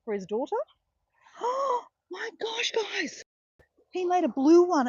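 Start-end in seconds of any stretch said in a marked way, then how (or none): 1.4-4.9 gasp, then a much higher, louder burst of delight; excited gasp. Real, not acted. Vlogger gasps to her viewers over blue glass, thrilled shout. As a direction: shriek it out, thrilled by the find.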